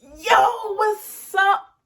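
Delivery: very high, loud "yo, what's up"; hyped-up greeting. Acted. Vlogger greets viewers with a catchphrase, hyped up and high. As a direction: belt out a high-pitched, showy catchphrase greeting. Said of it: "So I just hit it with a".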